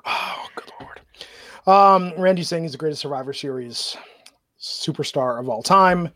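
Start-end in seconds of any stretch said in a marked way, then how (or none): 0.0-1.0 breathy whispered sigh of disbelief; whispered exasperation. Real, not acted. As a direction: mutter it under your breath, exasperated. Exasperated aside about Orton.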